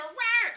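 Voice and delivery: a silly cartoon dino roar, mocking; mock dinosaur roar. Mocking a meme fan with a hammed-up loud high rawr.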